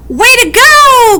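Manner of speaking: high, loud cheer. A narrator's reading. Friends cheering Weasel after the wolf. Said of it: "cried Wombat and Woodchuck".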